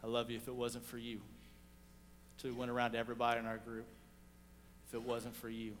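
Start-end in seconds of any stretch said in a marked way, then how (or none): 0.0-1.2 softer and lower, heartfelt; tender thanks. Retold or imitated. He hugs each member. 4.9-5.8 softer and lower, heartfelt; tender thanks. Retold or imitated. He hugs each member.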